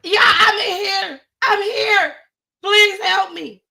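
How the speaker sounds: high, loud wailing plea for rescue; retold tearful shout for help; boohoo crying. Trapped woman calls to rescuers from the elevator, crying shout. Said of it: "I'm still boohoo crying".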